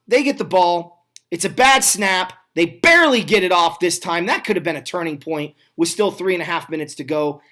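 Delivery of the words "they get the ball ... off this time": usual speaking voice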